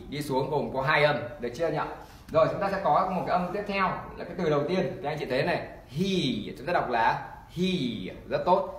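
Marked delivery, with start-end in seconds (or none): 5.9-6.5 "he" with a long ee; long E demo. Shown as a demonstration. Teacher models "he" for learners, long E sound. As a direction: hold the vowel in "he". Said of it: "Âm long E thì các anh chị sẽ đọc là Y và Y".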